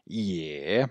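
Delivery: YÉ as an i glide into é, drawn out; soft, careful "Yé"; pronunciation demo. Shown as a demonstration. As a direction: model "Yé" slowly, ending on é. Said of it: "le E se prononce".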